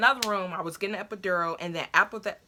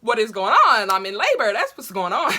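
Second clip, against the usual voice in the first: much louder, emphatic outburst; loud exclaiming; 2 semitones higher, 10 dB louder than usual. Retold or imitated. Telling herself she is in labor, loud exclamation. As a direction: belt it out in mock disbelief. Shouting about being in labor.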